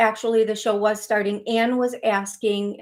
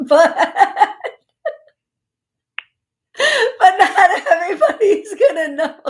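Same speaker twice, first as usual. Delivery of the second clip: loud, high laughing words; 9 semitones higher, 8 dB louder than usual. Real, not acted. Host giggling over her own word for the area, laughing. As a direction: talk right through a big laugh.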